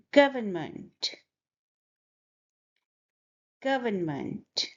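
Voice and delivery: "Government" carefully sounded out, first syllable stressed; slightly exaggerated pronunciation. Shown as a demonstration. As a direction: overdo the sounds of "Government" a bit for learners. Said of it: "I'm exaggerating the sound a bit".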